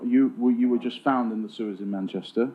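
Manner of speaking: usual speaking voice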